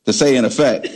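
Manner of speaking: normal talking voice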